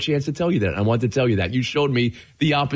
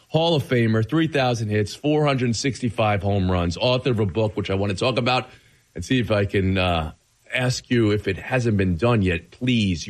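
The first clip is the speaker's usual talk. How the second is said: ordinary talk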